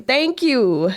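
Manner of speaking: overwhelmed with gratitude; tearful thanks. Real, not acted. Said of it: "I'm tearing up".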